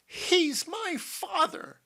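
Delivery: loud, high, drawn-out declaration; triumphant. A narrator's reading. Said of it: "finished Missus Dobson triumphantly".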